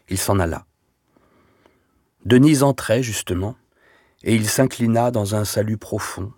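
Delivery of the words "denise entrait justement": plain reading voice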